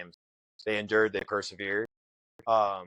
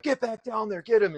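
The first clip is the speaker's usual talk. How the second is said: retold instructors yelling; 11 semitones higher, 4 dB louder than usual. Instructors yelling at him in pool.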